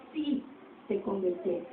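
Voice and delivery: drops almost to a whisper; quiet repeated echo; hushed echo. Shown as a demonstration. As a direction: let it fall away quietly. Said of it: "repeated, much quieter".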